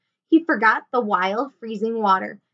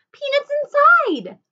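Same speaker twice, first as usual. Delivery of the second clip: high, loud, drawn-out announcement; thrilled, stretched-out high cry; excited cry; 6 dB louder than usual. A narrator's reading. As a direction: call out the joyful discovery. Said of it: "cried Annie".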